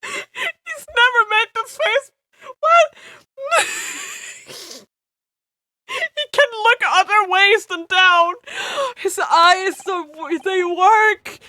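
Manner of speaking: high, loud, delighted disbelief; excited laughing. Real, not acted. Player gushing over a character's new face, high and loud. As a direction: gush in high giddy amazement.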